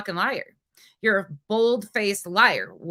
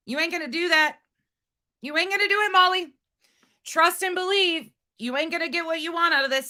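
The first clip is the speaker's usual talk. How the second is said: high, sing-song taunt aimed at a rival; taunting warning; 8 semitones higher than usual. Real, not acted. Streamer warning Molly in a high, taunting sing-song. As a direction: needle her in a high mocking lilt.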